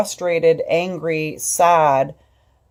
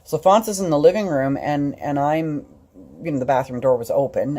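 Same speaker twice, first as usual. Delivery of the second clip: normal talking voice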